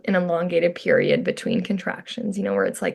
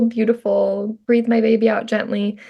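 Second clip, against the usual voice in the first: ordinary delivery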